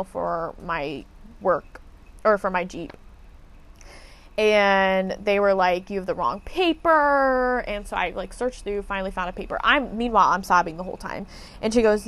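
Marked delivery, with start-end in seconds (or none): 5.9-7.6 higher, slow, with the last word stretched out; mocking whiny imitation. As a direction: mimic a fussy clerk, dragging out PAPER. Mocking retold clerks' complaint.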